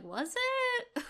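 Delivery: high, disbelieving "was it?"; mock doubtful. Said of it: "and I was like".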